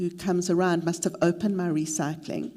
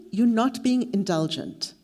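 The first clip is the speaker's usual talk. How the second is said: normal talking voice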